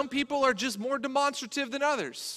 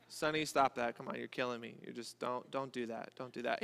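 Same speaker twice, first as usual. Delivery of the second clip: much softer, lower, half-hearted pleading to the dog; weak pleading; 10 semitones lower, 14 dB quieter than usual. Retold or imitated. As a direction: plead limply, no force at all. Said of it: "He doesn't listen".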